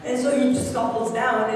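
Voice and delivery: normal talking voice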